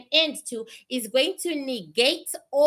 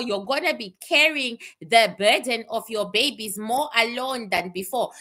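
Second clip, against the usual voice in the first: ordinary talk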